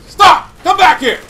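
high, loud yell at a fleeing enemy; calling out. Real, not acted. Yelling at a fleeing player on screen, loud and high.

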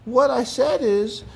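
high-pitched, sincere character voice; earnest Mister Packrat, realizing. A narrator's reading.

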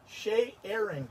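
"shea airing" drawn out slowly as two words; playful split pronunciation. Real, not acted. Thanking a viewer for sharing, "shea airing" playfully drawn out in two parts. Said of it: "That's a good word".

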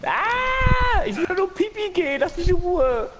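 very high, loud whining; whiny high voice. Pretend. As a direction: whine like a desperate child. Voicing character needing toilet.

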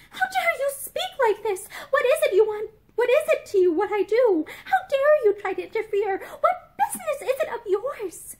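high-pitched indignant tirade; outraged rebuke. A narrator's reading. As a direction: fire off affronted questions, pitch up. Outraged woman rebukes the suitor.

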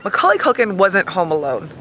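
normal talking voice

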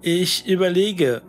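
plain reading voice

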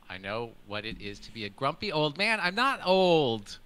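ordinary delivery

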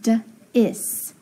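d, then is, said separately; sounding out. Shown as a demonstration. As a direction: sound out d-is slowly in two parts. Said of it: "So I can say".

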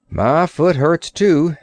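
slow, lightly voiced sailor; a hint of a weary old sailor. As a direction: sailor sitting down, slow and tired. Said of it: "said the sailor".

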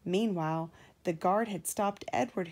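usual speaking voice

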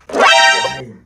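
usual speaking voice

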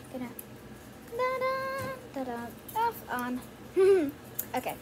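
ordinary talk

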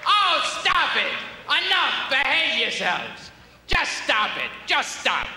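ordinary talk, nothing marked

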